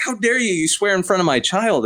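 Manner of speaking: high-pitched mock outrage; offended mother, pitched well up; angry mom. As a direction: imitate an indignant parent's scolding. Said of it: "and she was angry".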